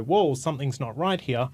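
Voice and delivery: normal talking voice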